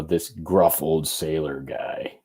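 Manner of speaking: hint of a salty old seaman, slowed down; gruff old sailor voice. Shown as a demonstration. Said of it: "That was my man voice".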